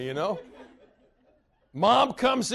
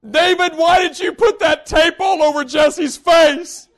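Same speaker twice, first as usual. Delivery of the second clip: louder, higher, slow scolding shout; mom yelling; 6 semitones higher, 10 dB louder, slower than usual. Retold or imitated. Mother shouting at David over the taped-up little brother. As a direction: yell down the hall at the older boy.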